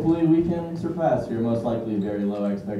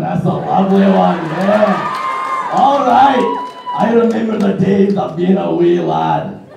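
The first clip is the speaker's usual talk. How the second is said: mock Scottish accent; 5 semitones higher, 6 dB louder, slower than usual.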